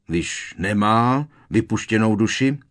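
loud, high, irate question; barked. A narrator's reading. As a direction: bark it indignantly. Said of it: "vyštěkl strýc Vernon".

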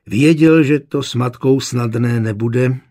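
usual speaking voice

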